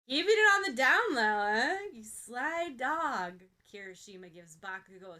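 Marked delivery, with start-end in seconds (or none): 0.1-3.4 loud, fast ribbing, slowing on sly dog; loud buddy teasing drawn out at the end; gleeful teasing. A narrator's reading. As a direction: rib him with a big grin. Kirishima teasing Bakugo.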